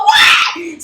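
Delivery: high-pitched shouted reaction; freaked-out disbelief. Retold or imitated. Driver hearing about an escaped psychopath, freaked out. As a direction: yelp it in retold shock. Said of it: "So I'm freaking out".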